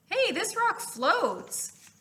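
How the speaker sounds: put-on high, slow amazed kid voice; excited kid. A student finds floating pumice, high and amazed. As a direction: marvel at the floating rock.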